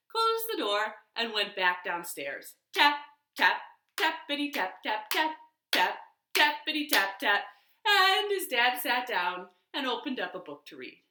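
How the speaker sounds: ordinary delivery, nothing marked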